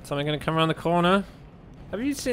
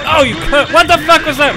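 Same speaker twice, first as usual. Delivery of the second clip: high, very loud outrage; real shocked gamer fury; startled angry yell; 14 semitones higher, 14 dB louder than usual. Real, not acted. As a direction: scream abuse at a sudden attacker. Ambushed by game enemy.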